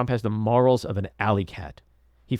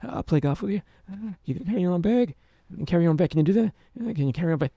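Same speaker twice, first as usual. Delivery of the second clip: weak mumbling version; 7 semitones higher, much faster than usual. Retold or imitated. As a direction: mimic a limp, half-hearted old man. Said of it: "If he said it like that instead of".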